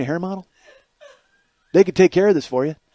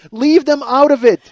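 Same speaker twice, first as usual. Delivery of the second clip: ordinary delivery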